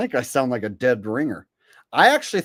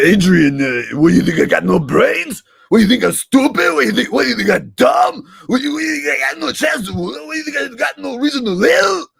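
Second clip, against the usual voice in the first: higher, much louder, frantic Rocky rant; Stallone yelling; 11 semitones higher, 8 dB louder than usual. Retold or imitated. Rocky yells at Adrian, frantic loud rant. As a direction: shout it out like an indignant Rocky. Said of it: "Sylvester Stallone impression".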